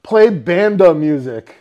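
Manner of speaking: deliberately slow to Alexa; slow, clear command. Retold or imitated. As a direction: enunciate for the speaker. Commanding voice assistant.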